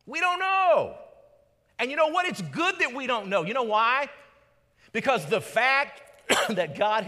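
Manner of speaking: sudden loud, high preaching burst; preaching shout. Real, not acted. Preacher calls out to the congregation, loud and high. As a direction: throw it out to the whole room.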